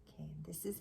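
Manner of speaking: soft unvoiced whisper. Real, not acted. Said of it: "unvoiced whisper".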